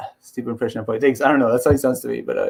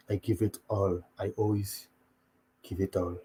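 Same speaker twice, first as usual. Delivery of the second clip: low, very soft, halting voice; Ngannou; 7 semitones lower, 13 dB quieter than usual. Retold or imitated. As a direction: imitate a humble fighter after a loss. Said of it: "when he lost to Stipe, he's like".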